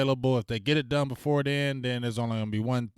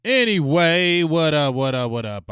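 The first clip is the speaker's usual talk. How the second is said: much louder, drawn-out anyway, higher; loud restart; 5 semitones higher, 9 dB louder, slower than usual. Real, not acted. Back to the stream after venting, loud greeting. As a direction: reset the energy with a shout.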